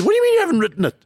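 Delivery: high, loud incredulous question; shocked disbelief. Retold or imitated. Journalist shocked he hasn't written his show, high and loud. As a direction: squeal it, can't believe what she heard. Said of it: "She said".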